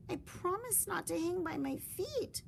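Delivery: high little bat with a hint of a sigh; lightly sighed promise; small rueful sigh. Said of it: "Stellaluna sighed".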